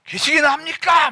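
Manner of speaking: high, loud challenge to God; defiant outcry. Acted. Pastor wrestling God with a loud challenge. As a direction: hurl the question up like a fight. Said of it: "막 이렇게 막 싸워야 돼".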